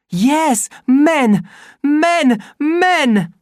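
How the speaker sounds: high, loud repeated cries; triumphant chant of men; exultant shouts. Acted. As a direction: hurl each word out the window. Helmholtz throwing poison, exulting.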